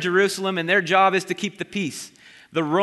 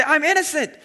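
pleading innocence; 8 semitones higher than usual. Retold or imitated. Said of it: "I would want to say".

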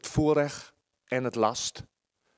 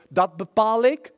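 louder, higher defiant snap; defiant retort; 4 semitones higher, 7 dB louder, slower than usual. Retold or imitated. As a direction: put your foot down. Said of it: "Ze zeggen".

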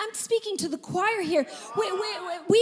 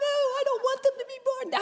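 put-on whiny child; 7 semitones higher than usual. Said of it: "And they're like".

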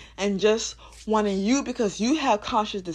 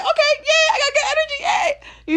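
much higher, louder, bubbly cheer; giddy spirit voice; 6 dB louder than usual. Retold or imitated. Spirit cheering over good energy, high and giddy. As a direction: squeal like a thrilled child. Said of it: "your spirit was like".

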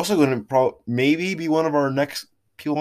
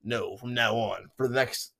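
soft low deep voice; 3 semitones lower, 9 dB quieter than usual. Pretend. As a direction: speak low and quiet. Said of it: "we're gonna be speaking like this".

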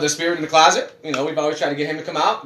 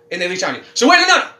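usual speaking voice

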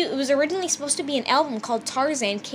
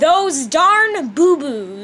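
slow, loud proclamation; loud and proud; 8 dB louder, much slower than usual. Shown as a demonstration. Reading the name aloud again on request. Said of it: "One more time, [name]".

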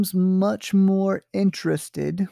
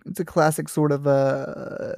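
normal talking voice

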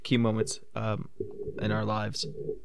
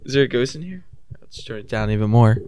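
clipping mic; 7 dB louder than usual. Real, not acted. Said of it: "you're still clipping".

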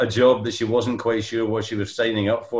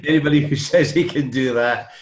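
laughing; 6 semitones higher, 4 dB louder than usual. Real, not acted. Laughs recalling Keegan's shot.

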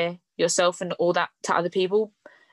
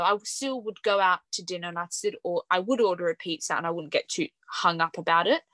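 ordinary delivery

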